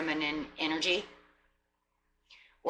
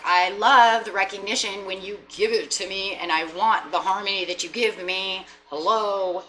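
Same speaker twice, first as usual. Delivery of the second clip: louder, higher emphatic plea; mock heart pleading; 3 semitones higher, 8 dB louder than usual. Open heart pleading loudly with partner for recognition. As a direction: demand the love, half joking. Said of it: "your heart is like".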